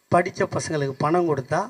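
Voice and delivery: ordinary talk, nothing marked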